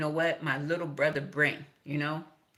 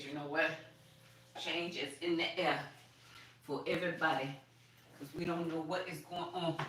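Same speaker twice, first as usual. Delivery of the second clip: hushed voice of God; 9 dB quieter than usual. Retold or imitated. God's voice quoted in hush.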